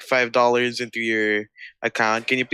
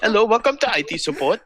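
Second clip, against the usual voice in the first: mock Indian IT support accent, higher; 9 semitones higher than usual. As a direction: play a cheery call centre agent with an Indian accent. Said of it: "I imagined the Indian accent instead".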